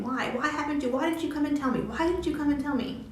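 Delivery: upset mom demanding. Retold or imitated. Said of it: "she's saying".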